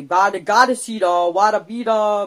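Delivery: playful gibberish syllables; nonsense gibberish. Retold or imitated. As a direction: babble like a scheming kid. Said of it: "but just, just gibberish".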